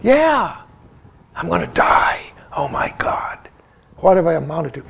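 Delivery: imitating a scared inner voice, hushed to a whisper; mock frightened whisper of someone facing death; fearful whisper. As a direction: breathe it out like dread creeping in. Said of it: "think the fear is there".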